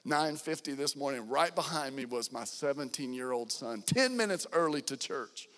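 ordinary talk

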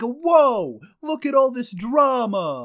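high, loud whoa with East Coast twang; East Coast accent. Acted. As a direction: announce the drama in a thick accent. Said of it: "you're going back into the accent".

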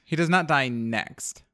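next drawn out and weighted, a slow teasing promise; slow deliberate tease landing on next; stress on next. Acted. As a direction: lean on the word next, slowly. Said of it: "I do like the emphasis on the word next there".

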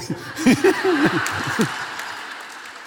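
airy, high burst of laughter; real laugh. Real, not acted. Laughing at own story.

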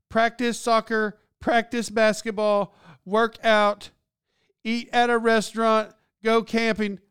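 put-on little girl reading. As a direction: read her list like an eight-year-old. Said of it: "she's reading".

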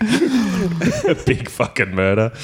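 slow, laughing high voice. Pretend. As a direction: drag it out through laughter. Joking confession.

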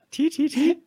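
high squeaky tee tee tee giggle; mock high giggle. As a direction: giggle like a tiny cartoon.

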